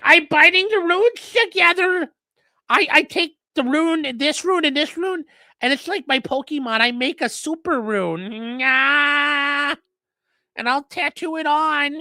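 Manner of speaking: high, slow nerdy mock voice; mock him in a high, slow, dorky voice; mocking Holder. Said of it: "He's like".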